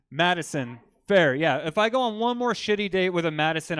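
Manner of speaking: normal talking voice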